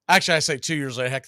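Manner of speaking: ordinary talk, nothing marked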